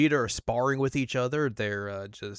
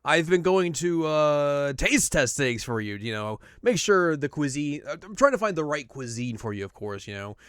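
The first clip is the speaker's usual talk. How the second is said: smooth talk your way out; Whis excusing; 3 semitones higher, 4 dB louder than usual. Retold or imitated. Whis justifying his Earth trips to Beerus, breezy. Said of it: "And Whis is like".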